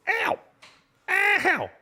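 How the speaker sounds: sharp high-pitched ow; high yelp. Real, not acted. Streamer yelping at a sudden sting, high yelp. Sudden pain while gaming.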